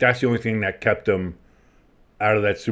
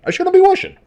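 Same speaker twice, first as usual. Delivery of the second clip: much higher, louder comic squeak; squeaky mock whoosh; 4 dB louder, faster than usual. Shown as a demonstration. Joking about his own sound effects, high squeaky mock voice. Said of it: "I don't know why I do the whooshing".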